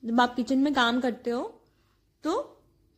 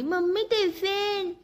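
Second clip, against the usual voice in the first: put-on higher drawn-out child's call; son calling out; 6 semitones higher, slower than usual. Son calls mother for his tiffin, drawn-out call. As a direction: call for the lunchbox like a kid. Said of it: "बेटे ने आवाज मार दी".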